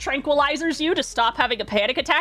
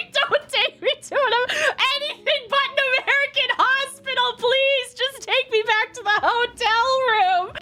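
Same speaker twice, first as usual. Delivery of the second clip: high-pitched hammed-up begging; retold pleading; 12 semitones higher than usual. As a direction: plead in a squeal, half laughing at yourself. Acting out drunken hospital plea.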